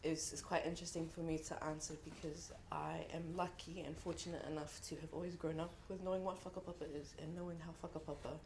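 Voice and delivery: ordinary talk, nothing marked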